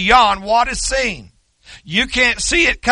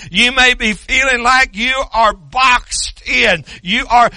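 ordinary delivery

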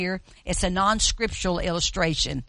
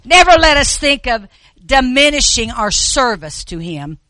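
preaching; 6 semitones higher, 9 dB louder than usual. Real, not acted. Preaching at full force.

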